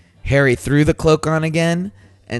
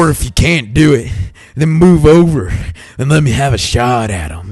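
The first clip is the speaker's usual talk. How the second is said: put-on a light southern accent, a bit louder; 6 dB louder than usual. Host voicing Harry for his cohost, light southern accent. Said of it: "I'm gonna give him a southern accent".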